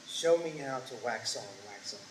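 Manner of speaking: low, hushed, unruffled command; quiet master. Retold or imitated. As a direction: answer the rant softly and low, as the teacher. Calm master answers the furious student.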